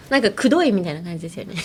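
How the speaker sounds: ordinary delivery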